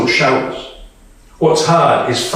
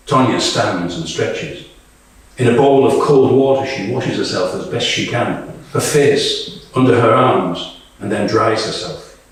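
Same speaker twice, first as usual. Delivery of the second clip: lower, even narrator reading of the action; stage direction narration; 4 semitones lower than usual. A narrator's reading. Narrator describing Tonya washing in the trench, lower level narration. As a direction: set the scene in a detached narrator voice.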